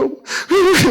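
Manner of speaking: high-pitched mock sobs; imitated crying. Pastor retelling how he wept alone, imitated sobbing. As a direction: act out sobbing all alone. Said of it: "막 혼자 우는 거예요".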